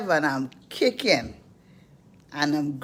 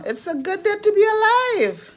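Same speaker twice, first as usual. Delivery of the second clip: joyful; 14 semitones higher, 6 dB louder than usual. Real, not acted. Joyful exclamation.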